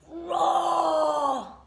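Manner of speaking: loud roaring word; hammed-up bear roar; mock roar. A narrator's reading.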